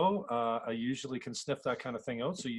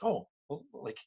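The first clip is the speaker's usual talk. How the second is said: high, loud mock bafflement; amazed; 9 semitones higher, 4 dB louder than usual. Host imitating himself watching a tech-savvy guest, amazed. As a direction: act out being stumped and impressed. Voicing a baffled computer novice.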